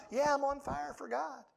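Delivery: imitating a man a week after the rally, going through the motions; half-hearted. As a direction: say it like the thrill is wearing off. A week later, enthusiasm fading.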